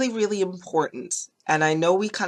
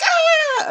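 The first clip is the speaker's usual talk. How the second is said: imitating a child's shrill yelp, much higher; mock child's cry of pain, squealed high and loud; 6 dB louder than usual. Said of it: "they're not gonna be like".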